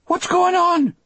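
very high, shrill shout; piercing, anxious cry from a distance; Delacroix, shrill call. A narrator's reading. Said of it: "Delacroix called shrilly".

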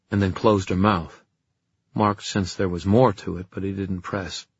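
usual speaking voice

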